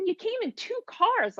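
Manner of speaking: high, slow and incredulous; mocking disbelief. Woman telling agents they came in two cars, incredulous. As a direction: point out the obvious in disbelief.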